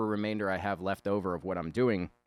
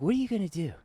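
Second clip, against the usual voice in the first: pitched-up mock question; 11 semitones higher, faster than usual. Voicing the other character.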